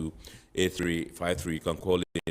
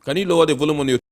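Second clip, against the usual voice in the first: to someone hard of hearing; 8 semitones higher, 10 dB louder, faster than usual. Real, not acted. Host asking caller over noise.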